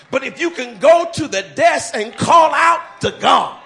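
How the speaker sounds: loud, rising pastor's cry building to shouts; full-force preaching. Acted. Pastor rousing the congregation about finding God, shouting. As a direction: preach it out big to the whole room.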